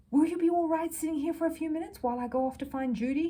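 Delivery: a hint of a worried mother asking kindly; lightly voiced Mrs Brown. A narrator's reading. Mrs Brown asks Paddington to wait while she finds Judy, lightly voiced and concerned.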